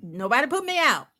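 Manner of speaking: usual speaking voice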